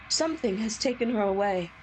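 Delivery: normal talking voice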